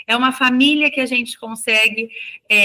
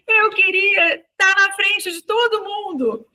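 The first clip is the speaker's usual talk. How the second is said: put-on high, slow, whiny little-girl voice; little girl's voice; 9 semitones higher, slower than usual. Teacher imitating the crying girl in a high, slow child's voice. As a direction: sound like a tearful four-year-old. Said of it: "Daí ela falou assim".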